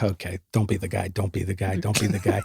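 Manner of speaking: hushed, muttered to himself; talking himself down under his breath; quiet inner warning. Retold or imitated. Golf cart worker warning himself quietly.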